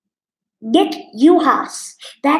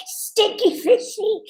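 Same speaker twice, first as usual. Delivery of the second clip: pitched-up, drawn-out comment voice; mock disgusted read; 7 semitones higher, slower than usual. Reading a commenter's jab at line-cutters, mock disgusted. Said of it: "Someone said".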